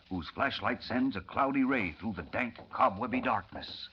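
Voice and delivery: measured, low narrator read; dark tunnel scene-setting narration; radio drama narration. A narrator's reading. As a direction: set the scene like an old radio announcer.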